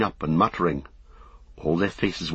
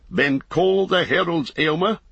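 commanding king; 10 semitones higher, 3 dB louder than usual. A narrator's reading. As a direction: order out the heralds.

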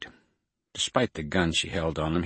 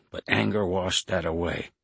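plain reading voice

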